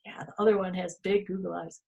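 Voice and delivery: ordinary delivery, nothing marked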